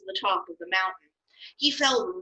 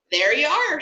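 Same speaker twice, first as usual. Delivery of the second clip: higher and louder, puffed up with pride; proud; 8 semitones higher, 6 dB louder than usual. Acted. Aaron presenting the golden calf to the people, proudly. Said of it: "said Aaron proudly".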